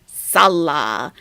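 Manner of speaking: Salah with a full, stressed first syllable, SAL-lah; drawn-out Salah, weight on SAL; misplaced stress. Retold or imitated. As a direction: imitate a learner saying SAL-lah for Salah. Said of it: "emphasis is on the wrong syllable".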